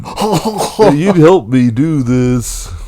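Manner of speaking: slow it right down and project in a put-on voice. Telling co-host about brother Adam, put-on voice. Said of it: "What was that voice?".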